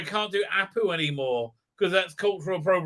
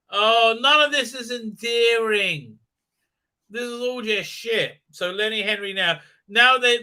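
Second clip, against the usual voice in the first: ordinary delivery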